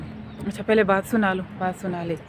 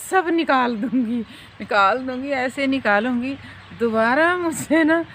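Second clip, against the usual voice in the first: louder, higher teasing threat to her son; playful threat; 5 semitones higher, 5 dB louder than usual. Retold or imitated. Mother teasing her lazy son, mock threatening and loud. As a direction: ham up the scrubbing threat.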